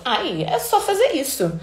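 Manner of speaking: smug, dismissive, with a little laugh; condescending. Retold or imitated. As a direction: toss it off like a know-it-all with a neck wiggle. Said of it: "Aí tem a cara, mexidinha de pescoço".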